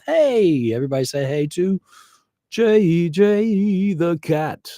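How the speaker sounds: high, very slow sing-song to the cat; cat intro voice. Real, not acted. Showing the cat to viewers, high slow pet voice. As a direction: coo the cat's name like a pet voice.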